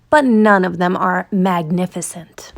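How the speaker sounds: ordinary delivery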